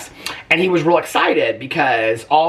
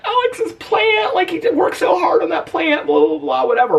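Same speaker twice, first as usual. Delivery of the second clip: upset high wail. Retold or imitated. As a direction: wail it like a heartbroken wife, half-mocking herself. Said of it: "I got so upset about it".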